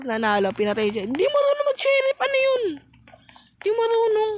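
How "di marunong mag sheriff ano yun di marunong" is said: squealed, high-pitched complaint about a teammate; high-pitched jeer. Real, not acted. Taunting a bad sheriff in game.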